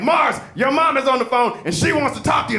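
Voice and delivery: office call-out. Retold or imitated. School office calling him.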